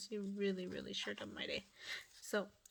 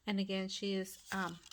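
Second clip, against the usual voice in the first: ordinary delivery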